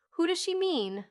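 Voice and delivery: high-pitched exasperated question; despairing. A narrator's reading. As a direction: ask it in mild despair. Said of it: "said Polly in despair".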